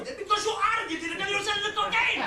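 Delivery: high, loud motherly outburst; accusing shout. Acted. Mother accusing her son of dealing, shouting. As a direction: explode at him as the exasperated mother.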